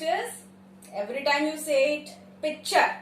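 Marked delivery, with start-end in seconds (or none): 2.4-2.9 picture as PICH-chur; PICH-chur, k swallowed; wrong picture. Shown as a demonstration. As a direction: say picture with no k, pitcher. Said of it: "Picture is wrong English".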